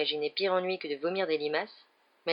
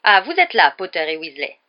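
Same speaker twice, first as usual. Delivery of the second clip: much louder call across the hall; ringing call; 10 dB louder than usual. Acted. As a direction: ring out sternly from afar. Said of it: "une voix retentit".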